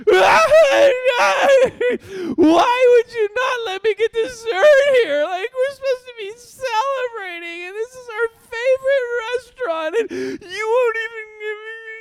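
high, loud mock sobbing wail; a little boy bawling over no dessert; crying child. Pastor imitating his son sobbing about dessert, high loud crying. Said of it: "he is crying".

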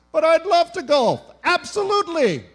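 overjoyed yes to free golf; eager acceptance. Retold or imitated. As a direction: jump at the offer gleefully.

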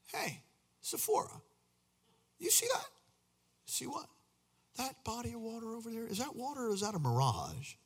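lightly voiced wanderer squinting at far water; a traveller calling to his wife about a mirage; hint of a curious wanderer; Israelite at the desert. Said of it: "And you're like".

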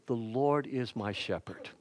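hushed, reverent Lord is my shepherd; soft recitation. Shown as a demonstration. To the congregation, reciting the psalm in a hushed reverent voice. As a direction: read the line softly and reverently, LORD gently falling. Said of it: "how the imagination is used there".

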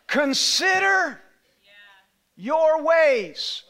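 very slow, high, loud "Consider"; slow dramatic quote. Acted. Pastor quoting God to the church, slow and loud. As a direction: stretch each word for weight.

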